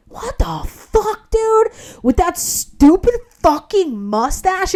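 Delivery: high-pitched, drawn-out outrage; indignant rant. Retold or imitated. Applicant ranting at the mustached manager, indignant. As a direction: let it squeal up in indignation.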